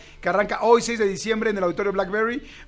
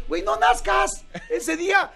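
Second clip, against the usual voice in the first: mock shout; 10 semitones higher, slower than usual. Joking to a woman about to give birth.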